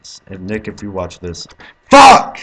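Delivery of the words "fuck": short scream, far higher and louder; sudden scream. Real, not acted. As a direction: shriek as you fail. Dies while apologising to Nick.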